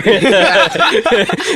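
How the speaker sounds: high real laugh; burst of laughter. Real, not acted. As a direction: crack up at your own joke. Laughing at elevator chatter.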